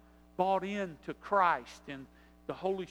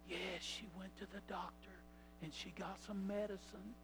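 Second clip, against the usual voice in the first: very soft, hushed little voice; whispering niece; 13 dB quieter than usual. Acted. Niece reporting on her sick mother, whispering. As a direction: whisper it into the phone. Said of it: "she whispered".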